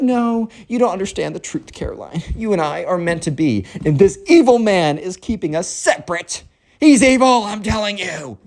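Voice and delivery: high, theatrical ranting; melodramatic spoiled kid. Acted. Spoiled kid accuses the evil man keeping them apart, melodramatic. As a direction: rant high, peaking on the accusation.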